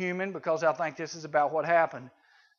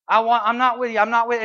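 loud, raised, flustered protest; insistent denial; 5 semitones higher, 8 dB louder, faster than usual. Acted. Simon Peter disowning Jesus in town, insistent denial. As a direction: deny it loudly and repeatedly.

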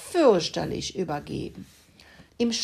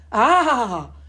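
loud, high startled cry; startled animal scream; 6 semitones higher, 8 dB louder than usual. Acted. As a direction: jump back with a scream. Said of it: "schrien plötzlich alle Tiere".

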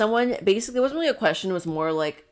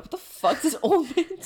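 incredulous outburst; 11 semitones higher than usual. Retold or imitated. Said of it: "in the background".

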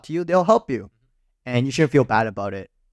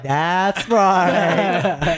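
higher, smug, drawn-out that's right; smug inner voice; 5 semitones higher than usual. Pretend. Friend voices his smug thoughts at the airport, smug. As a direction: savor it with swagger. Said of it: "you're like".

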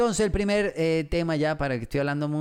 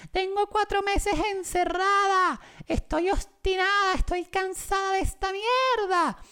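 very high, slow squeaky mock girlfriend; girlfriend's fed-up reply. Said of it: "Y yo le digo, ¿Pero qué te pasa?". (la novia harta, voz muy aguda y lenta)

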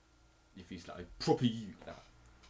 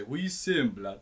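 roadman slang; 5 semitones higher, 12 dB louder, slower than usual. Shown as a demonstration. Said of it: "I do say".

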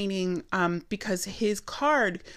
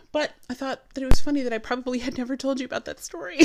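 amused, nearly laughing; 5 semitones higher, 6 dB louder, faster than usual. Real, not acted. Amused, breaks into laughter right after.

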